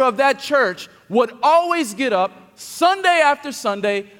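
loud, high, impassioned sermon climax; full-force preaching. Acted. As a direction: preach it out, building to a cry.

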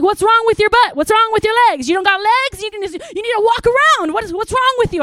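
high, loud scolding rant; yelling at her son. Retold or imitated. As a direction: lose your temper at a lazy teenager, full volume. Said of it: "I, like, lost my mind on him".

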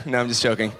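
ordinary delivery

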